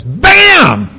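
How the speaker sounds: shouted high Bam!; Emeril's Bam. Retold or imitated. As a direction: toss it out like a TV chef.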